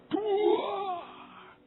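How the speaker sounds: high, drawn-out "duah" for a crash; imitated bang. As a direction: imitate a big bang without shouting it. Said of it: "bunyi keras".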